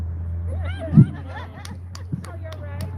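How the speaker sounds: a real laugh at himself, high and airy; short self-mocking laugh; amused laugh. Real, not acted. Laughs at getting older.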